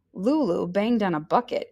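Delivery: ordinary delivery, nothing marked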